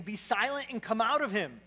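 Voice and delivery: loud, forceful command to a spirit; stern rebuke. Acted. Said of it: "Jesus rebuked him".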